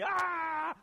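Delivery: high, loud charging yell; mock battle cry. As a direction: yell as if rushing an army. Imitates man charging at the army.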